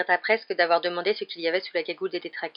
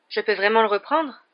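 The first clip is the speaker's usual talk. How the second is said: small, higher-pitched hopeful question; timid; 4 semitones higher, 5 dB louder than usual. A narrator's reading. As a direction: ask timidly, not quite believing it. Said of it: "demanda-t-il d'une voix timide". (timide, voix plus aiguë)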